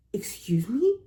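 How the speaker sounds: a sharp, breathy whisper of disbelief; indignant whisper. Real, not acted. Reacting to the woman taking his food, indignant whisper.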